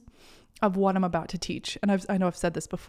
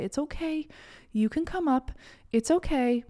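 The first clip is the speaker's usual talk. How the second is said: soothing; 6 semitones higher than usual. Acted. Welcoming painful parts with loving arms.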